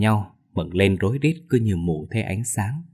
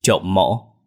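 loud blurt; 3 dB louder than usual. Acted. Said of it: "nói lớn".

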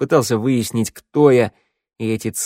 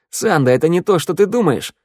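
higher, urgent protest; gabbled plea; 7 semitones higher, 4 dB louder than usual. A narrator's reading. Vorona explaining himself to Sanda, gabbling. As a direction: rush to explain, pitch raised. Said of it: "затараторил ворона".

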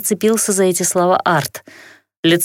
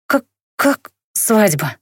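frightened; 2 semitones higher, slower than usual. A narrator's reading. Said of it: "перепуганно вытаращилась я".

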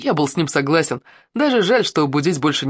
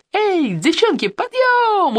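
very high, drawn-out holler; acted wake-up call; much slower than usual. A narrator's reading. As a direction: rouse the girls from a distance, pitched high. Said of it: "услышал я Ирин голос". (звонкий протяжный крик: подъём)

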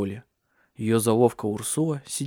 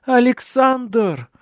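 high, loud, drawn-out cry of agony; terrible scream; 12 semitones higher, 6 dB louder, much slower than usual. A narrator's reading. Cecilia in agony calling for Alexander, screaming. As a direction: scream the name out in pain. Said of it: "Эхом отозвался её крик".

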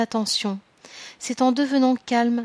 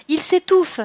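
shouted high and loud in alarm; a nurse crying out in sudden panic; 7 semitones higher, 4 dB louder than usual. A narrator's reading. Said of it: "cria une femme". (cri d'alarme, aigu et fort)

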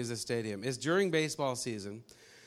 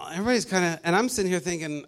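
ordinary talk, nothing marked

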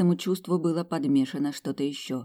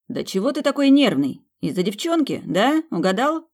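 loud teasing; 8 semitones higher, 5 dB louder than usual. Acted. Brother teasing loudly.

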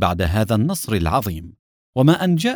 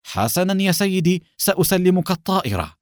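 reluctant yielding, pitched higher; higher-pitched, resigned compliance; reluctant consent; 7 semitones higher than usual. A narrator's reading. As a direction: give in to a superior. Officer yields after a pause.

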